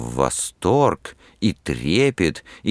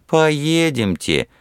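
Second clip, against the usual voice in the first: laughing; 11 semitones higher, 3 dB louder, much slower than usual. A narrator's reading. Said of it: "ответила она, смеясь".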